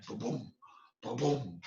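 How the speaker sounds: ordinary delivery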